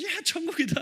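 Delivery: put-on high-pitched delighted kid; mock excitement. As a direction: imitate a kid suddenly thrilled. 아이들이 핸드폰 보며 신난 장면. (신난 아이 흉내)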